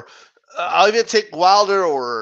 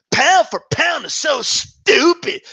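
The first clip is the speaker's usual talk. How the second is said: mock dismissive fan; 6 semitones higher, slower than usual. Mocking YouTuber's dismissal.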